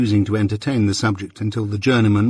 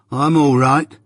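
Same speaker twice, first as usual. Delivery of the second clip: gruff, higher-pitched grudging answer; 4 semitones higher, 4 dB louder, slower than usual. Acted. Journeyman answering his master, gruff. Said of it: "said gruff old Orlick".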